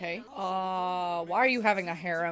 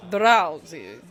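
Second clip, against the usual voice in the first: lightly imitated Dracula accent, punched out; 3 semitones lower, 7 dB louder than usual. Viewer mimicking how Drowsy's name sounds, mock Dracula accent. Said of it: "Sounds like Dracula".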